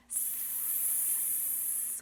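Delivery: long, soft sustained hiss; snake hiss. Shown as a demonstration. Teacher showing children the snake sound, long soft hissing. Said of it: "and say".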